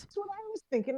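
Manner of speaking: a squeaky, soft echo of her own line; high soft repeat. Real, not acted. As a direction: float the repeat up high and hushed.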